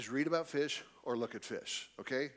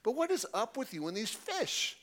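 put-on bigger Chicago accent, pitched higher with a rising end; Chicago version again; 8 semitones higher than usual. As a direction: push the Chicago accent harder, questioning. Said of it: "That's the Chicago version".

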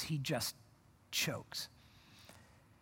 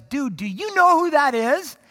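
high, loud, drawn-out question; astonished question; 7 semitones higher, 7 dB louder than usual. Acted. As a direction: nudge a friend in amazed excitement. John urgently asking Peter.

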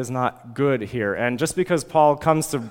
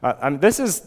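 ordinary talk

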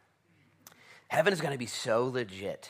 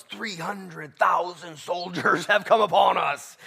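slow, loud, higher cry of the terrified enemy; panicked enemy; 8 semitones higher, 8 dB louder, slower than usual. Acted. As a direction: spell out the fear of being overrun. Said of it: "And they thought to themselves".

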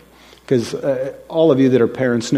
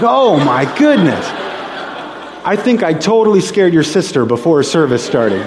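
high, loud mock dismay; astonished realisation; 5 semitones higher, 3 dB louder than usual. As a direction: exclaim as the embarrassing mistake dawns on you. Twin mix-up dawns on girl.

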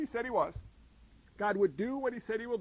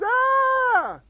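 high, loud mock shriek of panic; panicked scream; 13 dB louder than usual. Preacher acting out the world panicking, shrieking. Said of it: "the rest of the world goes".

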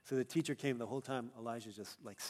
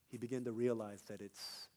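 normal talking voice

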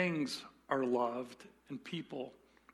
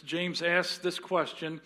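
ordinary talk

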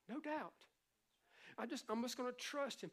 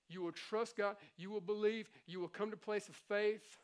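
ordinary talk, nothing marked

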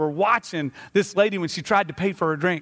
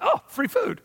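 delighted surprise; 13 semitones higher, slower than usual. Retold or imitated. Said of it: "So I was like".

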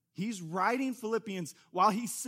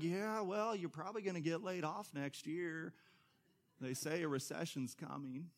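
softer, deflating dismissive reply; gloomy naysayer; wet blanket; 7 dB quieter than usual. Retold or imitated. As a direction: let the air out of their news.

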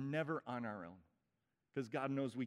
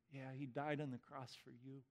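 ordinary talk

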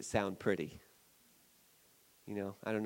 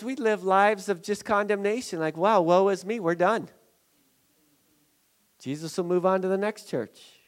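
loud, raised preaching voice; impassioned sermon, voice lifted; full-force preaching; 10 semitones higher, 9 dB louder than usual. Acted. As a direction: press it on the congregation.

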